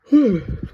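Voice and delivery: big tired yawn out loud; long sleepy exhale; yawning sigh. Real, not acted. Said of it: "I don't know why I'm yawning so much".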